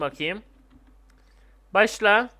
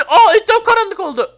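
high, loud cry of surprise; excited raised-voice shock; startled exclamation; 11 semitones higher, 9 dB louder than usual. Real, not acted. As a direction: react with sudden amazement. Darkness suddenly falls.

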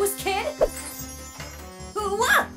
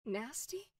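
low, very soft rising question; quiet menace; 7 semitones lower, 15 dB quieter than usual. Acted. Insulted nail owner to the kid, soft low menace. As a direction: echo the insult low and hushed.

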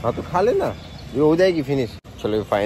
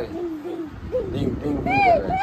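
ding ding imitation; slower than usual. Dad imitates toy bell sound.

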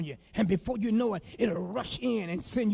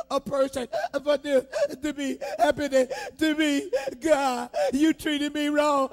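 hammed-up lament with a laugh and sob; mock wailing wife; 7 semitones higher, 7 dB louder than usual. Preacher imitating a wife complaining to God, mock wailing. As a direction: play a put-upon wife crying out to God.

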